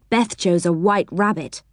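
ordinary delivery, nothing marked

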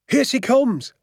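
high, excited call. A narrator's reading. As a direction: call out the news high and eager. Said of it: "my father called out".

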